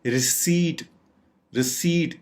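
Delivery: "रिसीट" with long drawn ee; correct pronunciation. Shown as a demonstration. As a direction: stretch "रिसीट" as ri-SEEET, no p. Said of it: "इसको असल में".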